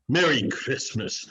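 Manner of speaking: usual speaking voice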